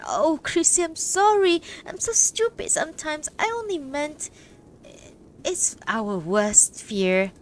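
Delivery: high, loud, pleading and sorry; upset apology. A narrator's reading. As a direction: beg forgiveness near tears. Food blending woman apologises, upset.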